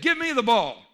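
loud, high-pitched bold demand; demanding lion. Acted. Lion calling to teammates for the ball, loud demand. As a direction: call for the ball like a cocky player.

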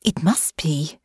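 whispered thought; inner thought. A narrator's reading. Honor thinks to herself in a whisper. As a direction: breathe it as a private thought. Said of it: "Honor thought".

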